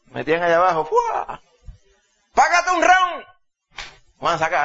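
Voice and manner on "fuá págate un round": high put-on voice of a freeloading pal; pushy friend. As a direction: egg him into buying a round. Friends urge him to pay.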